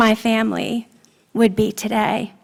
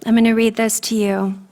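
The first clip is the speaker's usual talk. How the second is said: ordinary talk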